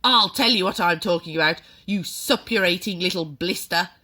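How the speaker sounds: loud menacing shout; shouted insult. A narrator's reading. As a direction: roar the insult at the boy. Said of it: "the Trunchbull shouted".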